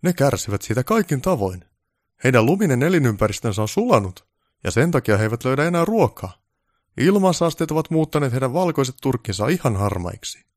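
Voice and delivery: self-important director holding forth; pompous film director, lecturing. Acted. Voices director Baltasar lecturing on reindeer.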